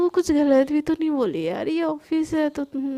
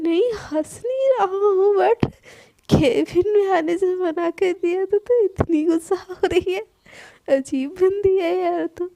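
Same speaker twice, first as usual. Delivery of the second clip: higher, louder, laughing through the words; laughing while talking; 4 semitones higher, 5 dB louder than usual. Acted. Friend laughs while calming angry Sana, laughing. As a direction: chuckle as you deny laughing. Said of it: "हंस रहा है तू".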